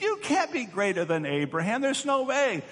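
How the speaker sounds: high-pitched mock protest from an outraged crowd; indignant crowd. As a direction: object in disbelief, pitch climbing sharply. Angry crowd objects to Jesus' claim.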